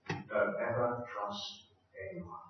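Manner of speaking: low, hushed, dropping to almost nothing; quiet warning. Retold or imitated. Father quietly warning his little son, hushed. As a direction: let the lesson sink in softly.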